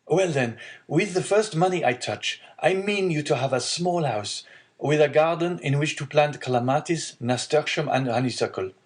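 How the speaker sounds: generous plans turning to concern, pitch lifted; devoted young son. Acted. Son promising father a house, then lightly voiced concern.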